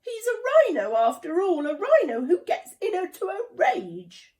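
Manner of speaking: high, loud, nervous objection; worried protest. A narrator's reading. Zora arguing against inviting the rhino, high and loud. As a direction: push back anxiously against the idea.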